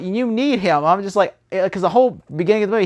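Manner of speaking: usual speaking voice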